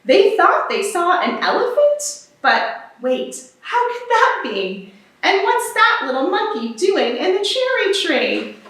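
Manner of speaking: ordinary delivery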